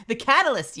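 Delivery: high, loud dramatic declaration; loud exclaim. Pretend. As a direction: announce it grandly. Teasing repeat of Mel's word.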